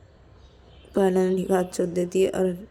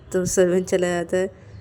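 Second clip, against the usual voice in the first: ordinary delivery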